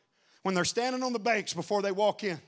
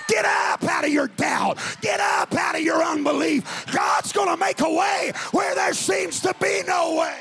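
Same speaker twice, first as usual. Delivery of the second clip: preaching at full force; 6 semitones higher than usual. Acted. Preacher shouting to the church. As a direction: preach it out to the congregation.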